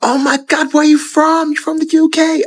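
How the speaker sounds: high, excited squeal of delight; squealing excited American; gushing American fan. Retold or imitated. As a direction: gush like a starstruck local meeting a Londoner. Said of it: "they're like".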